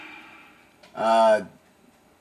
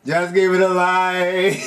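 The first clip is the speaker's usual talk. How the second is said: slow, higher Jamaican patois; Jamaican accent; 4 semitones higher than usual. Retold or imitated. Player copying the game's line in a Jamaican accent. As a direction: drawl the line dancehall style. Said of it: "I can't do the accent right".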